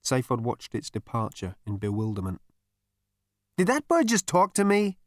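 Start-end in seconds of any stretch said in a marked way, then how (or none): 3.6-5.0 high-pitched, jittery asking; nervous question. A narrator's reading. Zaphod asking Marvin about the bird, nervous. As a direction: ask it nervously, pitched right up. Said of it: "he asked Marvin nervously".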